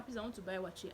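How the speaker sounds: ordinary delivery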